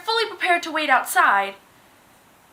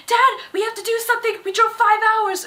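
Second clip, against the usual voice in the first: put-on much higher, fast pleading girl voice; pleading daughter; 9 semitones higher than usual. Fan girl pushing her father to do something, high and fast pleading. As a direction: beg dad to fix it. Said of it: "she pushed her dad".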